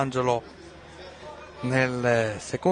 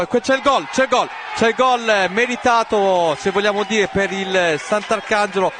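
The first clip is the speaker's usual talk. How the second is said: breathless italian goal commentary; 6 semitones higher than usual. Real, not acted. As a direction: erupt with joy at the goal. Goal scored, commentator erupts.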